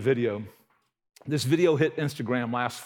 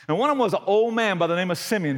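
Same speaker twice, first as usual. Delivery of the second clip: ordinary talk, nothing marked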